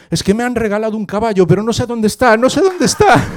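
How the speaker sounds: high, fast, building to a loud excited cry; overjoyed child. Acted. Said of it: "Porque estoy muy feliz". (niño eufórico, cada vez más agudo y fuerte)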